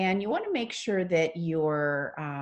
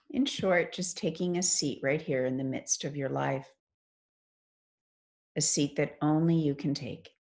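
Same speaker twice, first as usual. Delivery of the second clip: guided meditation. Acted. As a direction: ease them into stillness, phrase by unhurried phrase.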